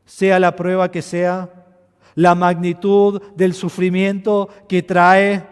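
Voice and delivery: loud, slow, stretched sermon build; slow emphatic preaching. Acted. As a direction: hammer each phrase slowly. (predicación lenta y enfática)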